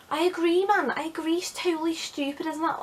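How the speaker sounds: high-pitched put-on Scouse; Liverpudlian accent. Pupil agreeing with the detention guy, Liverpudlian accent. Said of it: "we weren't Liverpudlian".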